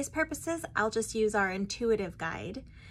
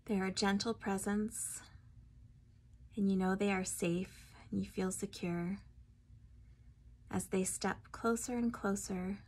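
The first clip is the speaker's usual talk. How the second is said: guided meditation; 5 dB quieter than usual. Acted. Guide leading listeners to meet their spirit guide, guided meditation. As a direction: ease them inward, phrase by phrase, unhurried.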